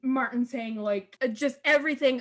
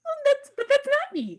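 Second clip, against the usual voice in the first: much higher, louder mock-charming Martin; Martin's charming turn; 6 dB louder than usual. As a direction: flip to a sweet smiling innocence. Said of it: "cute little bearded Martin smile".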